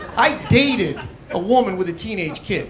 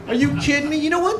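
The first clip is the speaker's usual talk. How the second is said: indignant disbelief; 7 semitones higher, faster than usual. Real, not acted. Indignant outburst, pitch jumps.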